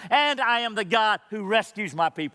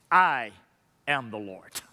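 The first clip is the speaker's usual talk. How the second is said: voice of God; 10 semitones lower than usual. Retold or imitated. God's answer to Pharaoh, voiced.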